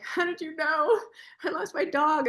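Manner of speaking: emotional stranger. Retold or imitated. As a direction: play the touched stranger blurting out her loss. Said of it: "You're like".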